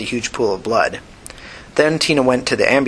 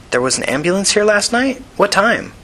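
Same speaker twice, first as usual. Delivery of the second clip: high, eager questioning; urgent surprise; 9 semitones higher than usual. A narrator's reading. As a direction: seize on the clue with lifted pitch. Tina seizes on a clue.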